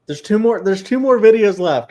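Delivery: high-pitched, emotional outburst; excited, emotional. Real, not acted. Co-host realizing the series is nearly over, excited and emotional. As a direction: let real excitement lift the pitch high. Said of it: "I'm just getting, like, really, like, excited, but kind of emotional".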